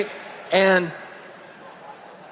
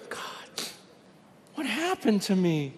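whispered prayer, voice falling away; hushed, stricken question; 8 dB quieter than usual. Retold or imitated. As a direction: breathe it out in dismay. Retold prayer, voice drops away.

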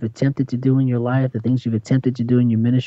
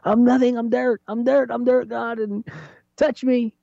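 much higher, pleading voice; desperate David; 9 semitones higher than usual. Retold or imitated. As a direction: cry out as a broken psalmist. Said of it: "He's like".

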